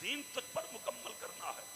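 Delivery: soft hushed voice of God speaking to the beloved; tender quote. Retold or imitated. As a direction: drop to a tender hush. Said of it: "मगर रब ने कहा".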